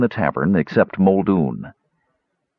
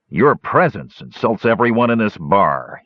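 plain reading voice

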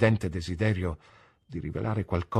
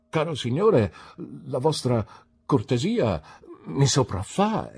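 halting, broken-up thanks, higher; stammering; 6 semitones higher, 4 dB louder than usual. A narrator's reading. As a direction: stumble over the words, pausing. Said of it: "riuscì solo a balbettare". (balbettando, commosso e impacciato)

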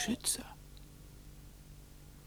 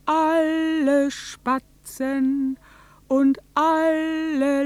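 rising, louder declamation slowing toward the end; impassioned speech; 5 semitones higher, 10 dB louder, much slower than usual. Acted. Proclaiming the day the sun won't rise, swelling. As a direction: build it like a prophecy.

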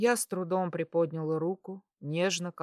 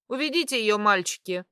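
higher, louder command to underlings; sharp order; 5 semitones higher, 6 dB louder than usual. A narrator's reading. Woman in charge ordering the orderlies, sharp command. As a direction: order them to take her away.

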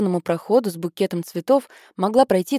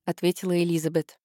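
usual speaking voice

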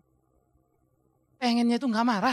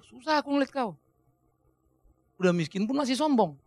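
ordinary delivery

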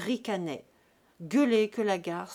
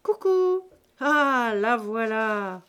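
playful magician; 2 semitones higher, 9 dB louder, slower than usual. A narrator's reading. Drunk Jésus-Christ showing off the coin to the room, teasing. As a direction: pull the coin out like a trick.

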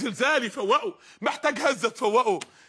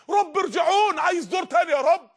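desperate cry; 5 semitones higher than usual. Acted. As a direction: cry out to be sent back. The dead begging for return.

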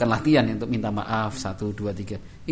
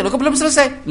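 high, loud indignant surprise; surprised father; 14 semitones higher, 8 dB louder than usual. Acted. Father finding the task undone, high and loud surprise. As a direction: catch him out in disbelief.

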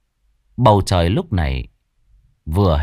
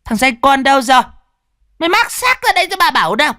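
high, loud furious shriek; shrieking rage; 4 dB louder, faster than usual. A narrator's reading. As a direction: screech at the boy in fury. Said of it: "nó rít lên".

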